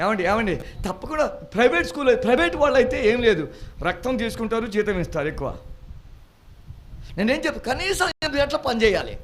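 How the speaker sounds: loud, high, fervent preaching. Acted. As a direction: preach it out to the congregation. Preaching rises again. (గట్టిగా ఉత్సాహంగా ప్రసంగం)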